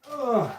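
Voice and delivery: loud high grunt lifting a heavy dog; effort grunt. Real, not acted. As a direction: strain as you heave him up. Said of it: "He's heavy".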